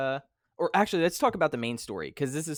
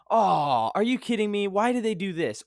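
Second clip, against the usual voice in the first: higher, louder exasperated complaint; frustrated outburst; 7 semitones higher, 7 dB louder than usual. Retold or imitated. A player fed up with the twist, loud and high exasperated complaint. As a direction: whine it out like a betrayed fan. Imagined fan upset at plot twist.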